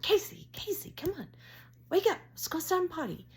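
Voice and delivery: very high, insistent pet-calling voice; urgent wake-up call. Retold or imitated. Woman clapping to wake deaf dog, high urgent calling.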